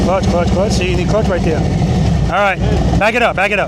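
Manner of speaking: loud, carrying cues across the practice lot; coaching calls. Real, not acted. Riding instructor shouting cues to a student on the bike, loud. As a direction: call the clutch rhythm out to the rider.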